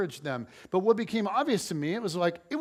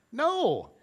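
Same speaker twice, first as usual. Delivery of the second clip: higher, sharper mock refusal; firmer no; 7 semitones higher than usual. Repeating the refusal to Jen, higher and firmer. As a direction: say no again, more emphatic.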